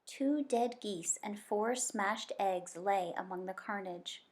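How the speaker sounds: usual speaking voice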